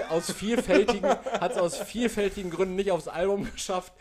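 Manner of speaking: laughing through the words; can barely talk for laughing. Real, not acted. Cracking up at own lyrics.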